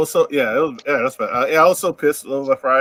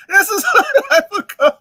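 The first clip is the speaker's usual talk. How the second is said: high laughing mock villain; laughing villain line; 4 dB louder than usual. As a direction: giggle through a villain line. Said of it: "repeats laughing".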